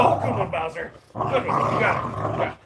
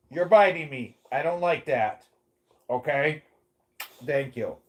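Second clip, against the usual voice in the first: normal talking voice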